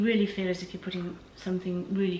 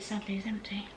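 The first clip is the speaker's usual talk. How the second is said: whispered little confession; mock sad whisper; 7 dB quieter than usual. Real, not acted. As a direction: whisper it like bad news. Admits the jar is empty.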